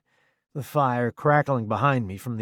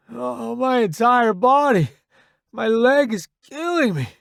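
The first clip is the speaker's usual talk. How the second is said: high, loud, strained groaning voice; injured soldier hurting all over; in pain; 12 semitones higher, 6 dB louder than usual. A narrator's reading. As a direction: complain through agony. Injured soldier answers about his pain.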